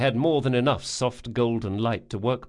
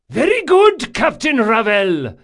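high, loud salty reply; eager shout; 12 semitones higher, 9 dB louder than usual. A narrator's reading. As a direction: bellow back an aye-aye. Sailing master answers captain.

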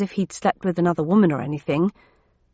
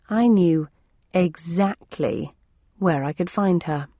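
ordinary delivery, nothing marked

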